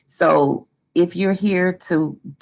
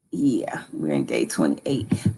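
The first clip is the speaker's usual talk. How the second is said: muttering; 11 semitones lower, 6 dB quieter than usual. Real, not acted. Muttered to self.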